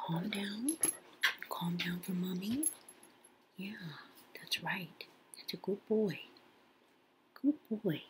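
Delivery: hushed, soft soothing voice. Real, not acted. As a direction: whisper to calm them down. Settling the baby.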